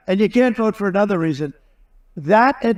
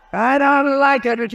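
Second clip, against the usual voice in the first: higher, slow, drawn-out mock whine; 7 semitones higher, slower than usual. Real, not acted. Speaker jokes to the crowd about his hair, high drawn-out whine. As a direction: stretch it out in a high playful whine. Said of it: "I'm looking at my hair up there".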